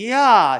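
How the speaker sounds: 야 sung out high and loud as one glide; loud teacher demo of 야; demo ya sound. Shown as a demonstration. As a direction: stretch out 야 from 이 into 아. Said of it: "야 하는 거죠".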